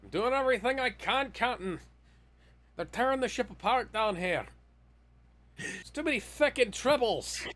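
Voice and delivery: high, frantic Scottish engineer; hammed-up Scottish ship's engineer shouting up to the captain; Scottish accent. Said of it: "the worst Scottish accent I've ever put on".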